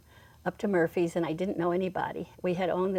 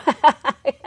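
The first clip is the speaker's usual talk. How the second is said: laugh; 6 semitones higher, 10 dB louder than usual. Real, not acted. Laughing at her own story.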